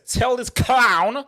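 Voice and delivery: loud, high, drawn-out call-out; aggressive taunt. Real, not acted. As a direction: stretch it out like a ringside challenge. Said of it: "very aggressive abuse towards you".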